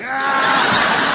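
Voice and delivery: high mock flying leap yell; Jon Stewart's yell. Jon Stewart jumping at the kid, high mock yell. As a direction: leap in with a shriek. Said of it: "He was like".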